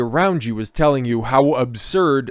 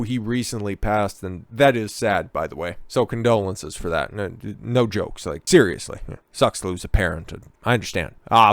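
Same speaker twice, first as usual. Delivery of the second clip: ordinary talk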